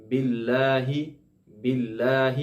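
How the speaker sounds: باللہ with light, thin lam. Shown as a demonstration. Teacher modelling باللہ for students. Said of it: "وہ ہے باللہ".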